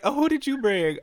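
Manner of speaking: high nosy guest voice. Retold or imitated. Imitating curious guests, high.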